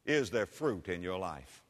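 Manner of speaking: low, soft, falling question; sober question. Real, not acted. Preacher searching the congregation's hearts, sober and quiet. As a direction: ask it quietly, letting it sink. Rhetorical question asked softly first.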